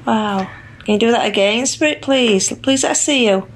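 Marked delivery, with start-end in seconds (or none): none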